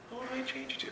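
high, soft mock whine; squeaky naive asker; mock whiny question. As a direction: play the clueless believer.

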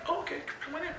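high, soft, trusting hostess voice; naive welcome. Pretend. The lady answering the knock, high, soft and trusting. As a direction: let him in without a second thought.

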